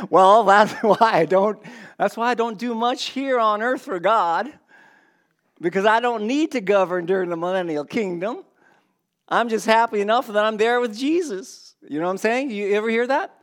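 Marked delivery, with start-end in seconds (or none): 0.1-11.8 higher, louder put-on Texan accent; mock a pious Texan, accent laid on. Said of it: "I'm sorry to you Texans out there".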